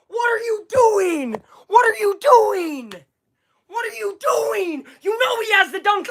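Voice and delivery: high-pitched exasperated wail; falling apart. Real, not acted. Fan streamer berating his player for fouling, distraught. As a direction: draw out each question in real disbelief. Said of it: "I'm falling apart".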